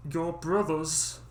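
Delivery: slow, put-on gruff father; stern father voice. Father warning Dummling about the woods. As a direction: lay it down slowly like an old father. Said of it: "Why does he have that voice?".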